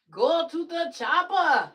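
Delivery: slow, loud Arnold action-hero line; Arnold Schwarzenegger. Shown as a demonstration. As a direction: bark it like Arnold. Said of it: "I can definitely do the".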